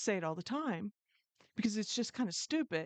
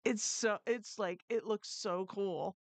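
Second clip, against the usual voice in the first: ordinary delivery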